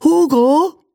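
put-on high, bright-eyed boy prince calling his servant; a young prince's voice, pitched well up. Prince calls his servant Hugo, in a high boy voice. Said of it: "sprach Ferdinand".

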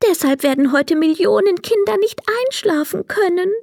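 gently regretful; fairy voice. Acted. Fairy tells Emil what she can't do, high and regretful.

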